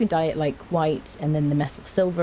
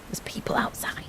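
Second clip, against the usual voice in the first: hush, people are out there; mock horrified whisper; 7 dB quieter than usual. Joking with Purple about outdoors, a mock-horror whisper. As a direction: whisper it as if it's a secret horror.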